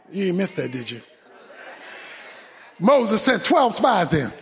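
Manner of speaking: ordinary talk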